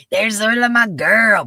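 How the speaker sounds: high, loud happy hello; excited greeting. Real, not acted. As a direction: call out to a friend. Greeting a chat member loudly.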